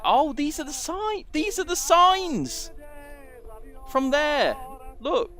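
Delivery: high, loud, drawn-out cry of recognition; excited discovery. Real, not acted. Gamer spotting the signs in the game, excited. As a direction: let the last word soar, stretched and high.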